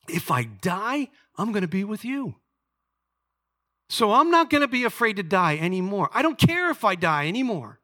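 high, loud surrender cry to God; desperate prayer. Retold or imitated. Teenager praying to God, loud and surrendering. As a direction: cry it out as if letting go of all fear.

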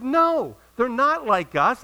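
high, loud acted objection; emphatic rebuttal. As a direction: contradict firmly, drawing out the words.

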